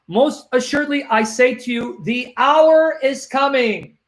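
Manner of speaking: slow, emphatic verse reading; slow scripture reading. Real, not acted. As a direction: read it slowly, drawing out the key words. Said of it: "I'm gonna read it slowly for you".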